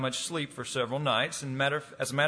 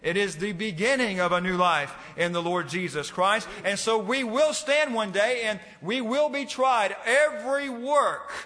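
raised, fervent preaching voice; 6 semitones higher than usual. Acted. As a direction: preach it with building passion. Preaching at full force.

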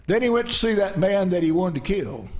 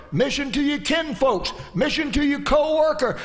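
raised, higher-pitched preacher's cadence; fervent preaching; 7 semitones higher than usual. Real, not acted. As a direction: drive each phrase home like a revival preacher. Preaches with raised, chanting delivery.

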